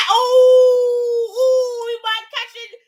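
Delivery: high loud yelps; shocked shout. Real, not acted. Watching a near knockdown, shocked shout. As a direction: yell as the punch lands.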